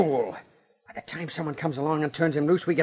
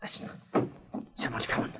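tense urgent whisper; 11 dB quieter than usual. Acted. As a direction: breathe it out so no one hears. Hiding, hears someone approach.

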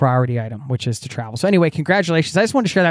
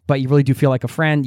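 ordinary talk, nothing marked

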